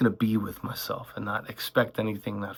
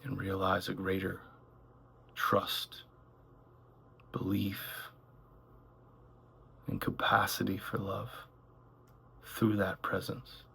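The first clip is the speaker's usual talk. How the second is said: calm meditation voice, gradually softening; unhurried phrases with long silences between them; guided meditation; 5 dB quieter than usual. Acted. Guide leading listeners through one breath at a time, guided meditation.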